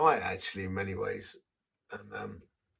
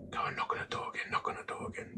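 urgent whispered inner voice; 4 dB quieter, faster than usual. Retold or imitated. The Holy Spirit urging him back to the door, whispered. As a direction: breathe it like a voice inside his head. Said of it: "It was whispering to me".

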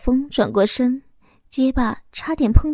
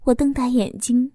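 ordinary delivery

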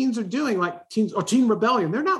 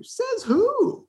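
high, pointed says who; defiant challenge. Acted. Coach challenging a common belief, high and defiant. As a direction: throw it back as a challenge. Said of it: "the world says".

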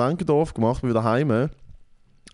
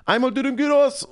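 shouted order; 12 semitones higher than usual. Retold or imitated. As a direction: belt the order back to the cooks. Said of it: "er schreit halt hinterher".

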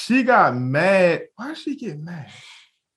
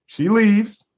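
usual speaking voice

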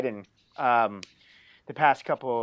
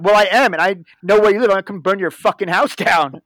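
loud, higher angry retort; heated, raised-voice retold threat; retold mad comeback; 3 semitones higher, 10 dB louder than usual. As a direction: re-enact yelling back in a phone fight. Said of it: "I, I was pretty mad".

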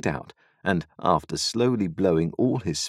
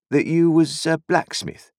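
sly stranger; 10 semitones higher, 5 dB louder than usual. A narrator's reading. Stranger voiced in story.

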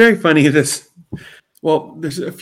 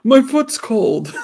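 high, slow complaint; whiny bookshelf guy; 9 semitones higher, much slower than usual. Retold or imitated. The bookshelf guy answering Eric, high slow whine. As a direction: whimper about a cold foot. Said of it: "Behind the bookshelf".

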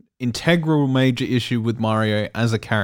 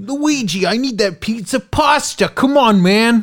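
high Brooklyn cartoon Mario voice; mock Brooklyn Mario; 10 semitones higher, 4 dB louder than usual. Mocking the cartoon Mario to his co-host, high Brooklyn accent.

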